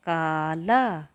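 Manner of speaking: "काला" drawn out slowly, both long a sounds held; slow clear word. Shown as a demonstration. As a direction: spell out "काला" slowly for children. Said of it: "का, का पर आ की मात्रा". ("काला" को बहुत धीरे, दोनों आ खींचकर बोलना)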